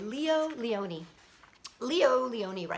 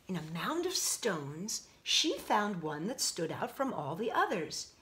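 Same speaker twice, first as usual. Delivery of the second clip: ordinary delivery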